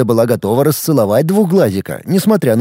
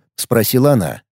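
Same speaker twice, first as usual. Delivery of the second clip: ordinary delivery, nothing marked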